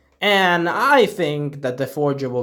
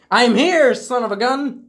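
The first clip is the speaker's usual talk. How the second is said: higher, louder mock taunt; taunting call; 9 semitones higher, 4 dB louder than usual. Mandalorian taunts Gideon from the grappling hook, loud mock call. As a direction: call out cockily to the enemy, pitched up. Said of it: "And he's like".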